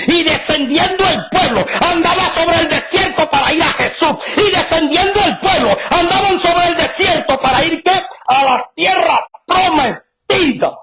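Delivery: shouted high preacher cadence with tearful aleluyas; fiery preaching. Acted. Preacher crying out to the congregation, fervent shout. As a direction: preach it out with full fervour.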